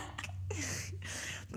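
airy breathless giggle; breathy giggle. Real, not acted. To co-host, an airy giggle after the catchphrase. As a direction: let a soundless giggle escape.

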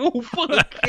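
high, put-on startled reaction; hammed-up alarm, pitch way up; mock shock. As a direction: pretend to be a stunned bystander. Friend's imagined shocked reaction.

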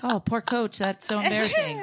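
high, sing-song pity; mock sympathy. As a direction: coo over the poor coach. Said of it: "I'm like".

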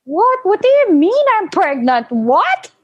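high-pitched mock indignation; indignant self-defense. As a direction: protest as if falsely accused. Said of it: "just to defend myself".

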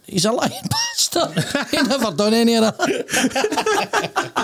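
high, breaking into laughter through the punchline; can barely get the punchline out for laughing. Retold or imitated. Telling the dog joke to studio guests, laughing through it.

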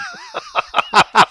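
sudden loud squealing laugh; high burst of laughter. Real, not acted. Reacting to the accent offer with a loud high laugh. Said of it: "It's pretty good".

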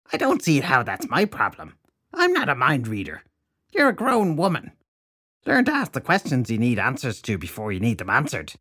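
put-on high, scornful old hag; scolding crone. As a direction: lecture her like a crone. Crone voice held over lines.

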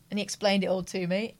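ordinary delivery

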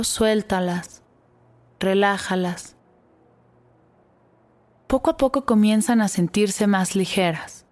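put-on calm guide easing children to relax; guided meditation. Guides a body scan meditation.

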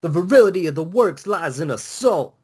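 high, hammed-up gangsta brag; gangsta rapper. Cohost raps Benjamin's line as gangsta, hammed-up brag. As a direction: swagger through it like a gangsta rapper.

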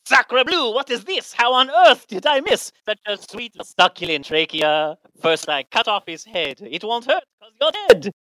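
high, hammy French chef voice; Louis the French chef. Retold or imitated. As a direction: sing it like a cartoon French cook. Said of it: "that's Louis from The Little Mermaid, the chef".

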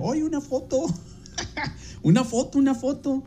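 high, soft fan's plea; a lightly voiced eager fan asking for a picture; timid photo request. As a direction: ask shyly, voice up high.

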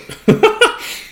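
short high unvoiced laugh after choking; high breathy laugh. Real, not acted.

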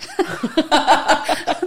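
high, loud laugh breaking out; burst of laughter. Real, not acted. Recalling the optician's boss, laughing out loud high. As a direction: let a real laugh burst out.

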